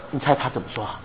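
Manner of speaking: ordinary talk, nothing marked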